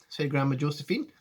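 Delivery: usual speaking voice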